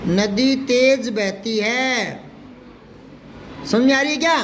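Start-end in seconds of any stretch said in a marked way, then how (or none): 0.1-2.2 every word stretched out; slow, deliberate classroom dictation. Shown as a demonstration. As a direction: dictate it at writing speed. Teacher dictates the example slowly.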